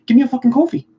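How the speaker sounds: put-on high, loud, rapid-fire Philly accent; hammed-up Philly coffee order accent; fast Philly accent. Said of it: "that's like a Philly accent".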